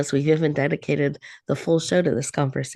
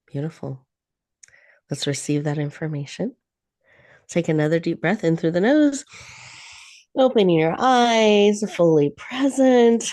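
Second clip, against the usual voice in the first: guided meditation. Acted. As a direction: ease them into calm breath by breath. Leads a guided breathing meditation.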